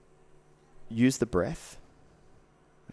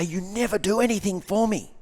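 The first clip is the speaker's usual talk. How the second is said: higher, pleading complaint as the wife; mock complaining wife; 11 semitones higher, 4 dB louder than usual. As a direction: play the hurt partner, voice lifted. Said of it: "she might say".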